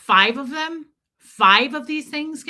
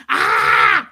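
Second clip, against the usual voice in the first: angry scream; 2 semitones higher, 10 dB louder than usual. Real, not acted. Outraged scream.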